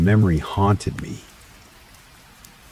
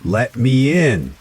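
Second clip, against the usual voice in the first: high, slow, louder angry cry; enraged scream; 7 semitones higher, 4 dB louder, slower than usual. A narrator's reading. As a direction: scream it with rage. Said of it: "the voice screamed, now filled with rage".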